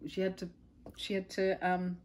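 ordinary delivery, nothing marked

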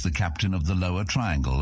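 ordinary delivery, nothing marked

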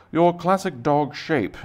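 mock-posh British delivery, stretching each word; terrible British accent. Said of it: "you do it with a terrible British accent".

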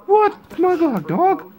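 high squeaky toy arguing. Pretend. Toy protests to another toy, high squeaky voice.

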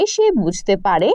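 usual speaking voice

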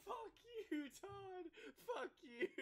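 high laughing curse. Real, not acted. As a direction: squeal it through laughter. Laughing at a teammate.

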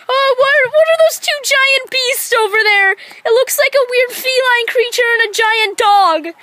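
spooked at the beasts; alarmed. Acted.

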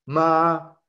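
MA held high and level; first tone, a long high level MA; high sustained tone. Shown as a demonstration. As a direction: sing MA on one high, even note. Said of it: "es como un sonido alto sostenido".

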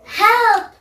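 loud high cry for help; shouting for help. Acted. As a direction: scream for help. Girl calls for help.

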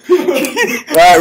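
laughing through the words. Real, not acted. Laughing after the challenge.

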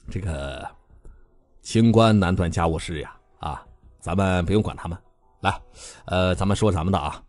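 soft, low embarrassed smoothing over; awkward polite smile. A narrator's reading. Beilong explaining the brawl away to guests, softly awkward. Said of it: "尴尬而又不失礼貌地微笑着".

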